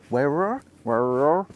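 broad Hampshire burr, words slurred together; Hampshire accent. Shown as a demonstration. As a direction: play up the rural Hampshire R sounds. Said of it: "That's my Hampshire accent coming out".

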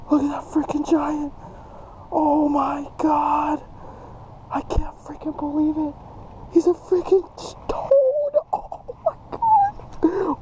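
high-pitched exclaiming, cracking into a squeal; ecstatic disbelief. Real, not acted. Angler with a giant caught on a lizard, ecstatic disbelief. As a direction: crow over it, voice shooting up. Celebrating giant fish catch.

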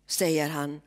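plain reading voice

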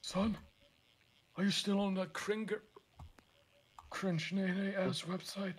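a hint of a stern father; disappointed dad. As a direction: scold like a let-down dad. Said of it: "You sound like a disappointed dad".

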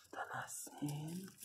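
whisper. Real, not acted. Whispering as puppy is born.

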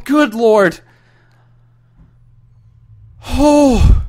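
high, strained terrified exclamations; frightened cries. Real, not acted. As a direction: cry out in dread. Terrified exclamations, nervous laugh.

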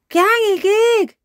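put-on high, loud, drawn-out baby cheer; cheering toddler. A narrator's reading. Little George copying his sister's cheer, babbling gleefully. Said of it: "cheered George".

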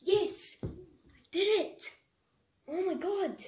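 high, elated cry of triumph; ecstatic shout. Real, not acted. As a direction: yell it out in triumph. Finally lands the trick.

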